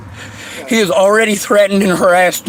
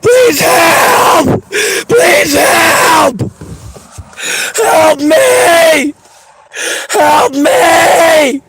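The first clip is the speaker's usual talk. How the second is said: tearful high shouted pleas; sob-shout for help over and over; crying for help; 5 semitones higher, 4 dB louder, much slower than usual. Real, not acted. Woman crying out for help outside.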